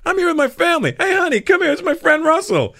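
high, loud, happy introduction. Retold or imitated. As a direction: show off a friend to the family. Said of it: "He goes".

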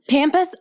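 "Pampas" pitched high with flat a of "cat"; mock the short a in "Pampas"; mimicked short-a pampas. Co-host mimicking the host's way, high "Pampas" with short a. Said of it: "it sounds like you're like".

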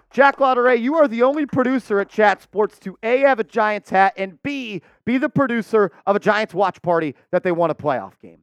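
big announcer build-up; hype announcer. Acted. Hyped introduction of producer.

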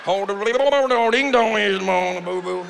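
slow, warbling mock Southern accent; mock yodel accent. Comic mocks reality show accent, slow warbling Southern drawl. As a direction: slur it like yodeling underwater. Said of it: "sound like they're yodeling underwater".

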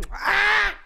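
high, loud mock shriek of pain; fake yelp. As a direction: pretend the bunny bit you. Pretends bunny bit her.

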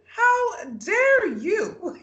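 very slow, high mock-outraged shout; indignant shout. Retelling Haley answering Jacob's accusation, hammed-up outraged shout. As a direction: stretch it out into a hammed-up outraged cry. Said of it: "Haley is so genuinely hurt by this".

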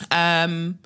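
normal talking voice

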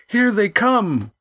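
high, urgent warning cry; alarmed cry. Acted. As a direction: cry out that they're coming. Crew shouting warning.

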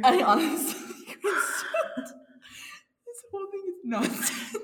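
can barely speak for laughing. Real, not acted. Giggling fit on air.

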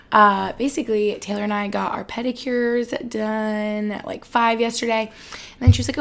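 usual speaking voice

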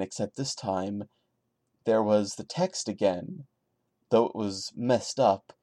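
ordinary delivery

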